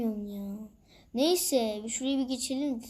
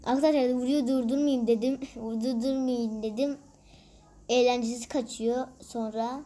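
usual speaking voice

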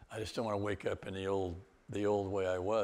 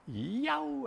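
a much higher ecstatic whoop; reliving a joyous car-window yell; joyful yell. Retold or imitated. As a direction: let out a wild happy whoop. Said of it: "I'm yelling and screaming".

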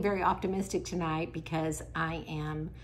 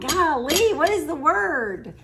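frustrated outburst; 10 semitones higher, 9 dB louder, much slower than usual. Real, not acted. Searching for a lost word.

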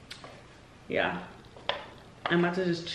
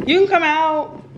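high, loud call to the door; 10 semitones higher, 7 dB louder than usual. Real, not acted. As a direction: call her out cheerfully. Calling friend out of house.

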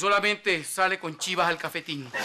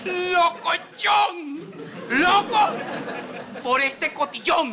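high-pitched comic shouting; shouted retort; 9 semitones higher, much slower than usual. Acted. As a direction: shout back in mock outrage, pitch way up. Shouted retort after the audience uproar. (gritos agudos de loco indignado)

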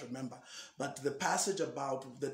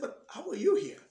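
high, louder, amazed question; puzzled question; 11 semitones higher, 5 dB louder than usual. Retold or imitated. Asks the stranger in his room how he got in, high and amazed. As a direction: suddenly realise he shouldn't be here.

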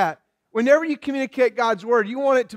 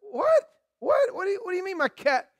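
high-pitched mock disbelief; shocked; 8 semitones higher, 5 dB quieter than usual. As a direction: play a stunned wife, squeaking up high then rushing. Acts wife shocked at cat's death.

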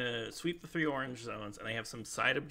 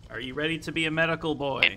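higher and louder to be heard; louder over noise; 6 semitones higher, 10 dB louder than usual. Real, not acted. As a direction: repeat it loud over a helicopter. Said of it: "I can't hear you over the helicopter".

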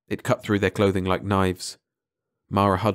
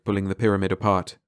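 ordinary delivery

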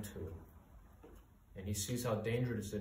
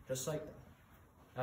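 hushed slow; 9 dB quieter, much slower than usual. Acted. Slow slide away from God.

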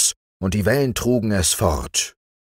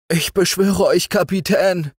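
schluchzend; 13 semitones higher, 4 dB louder than usual. Acted. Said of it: "schluchzte der Unglückliche".

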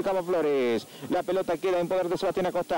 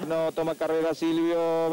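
ordinary talk

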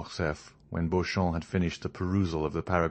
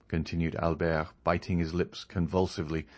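ordinary delivery, nothing marked

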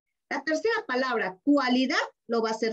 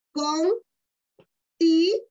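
syllable by syllable; 3 semitones higher, 4 dB louder, much slower than usual. Shown as a demonstration. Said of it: "voy a poner con".

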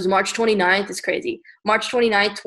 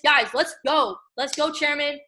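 ordinary talk, nothing marked

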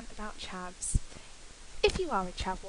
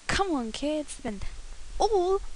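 excited; 5 semitones higher, 5 dB louder than usual. Acted. Mock makeup tutorial opener.

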